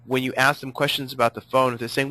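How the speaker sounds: ordinary talk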